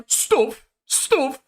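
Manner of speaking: Stuff said with a broad rounded northern vowel, high. Retold or imitated. Imagining Helga reading his email, broad northern Stuff. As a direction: say Stuff like a thick northern accent. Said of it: "I read it in my head like".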